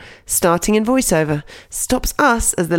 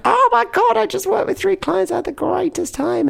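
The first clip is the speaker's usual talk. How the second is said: much higher gushing squeal; giddy, high-pitched excitement; excited gush; 13 semitones higher than usual. Retold or imitated. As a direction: gush about the clients like you can't contain it. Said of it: "me just being like".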